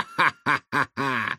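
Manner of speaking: hearty mad-doctor laugh; villainous guffaw. A narrator's reading. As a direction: burst into evil laughter. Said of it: "расхохотался доктор".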